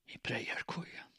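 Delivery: soft, voiceless whisper. A narrator's reading. Woman answering where they met, whispering. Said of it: "viskade hon".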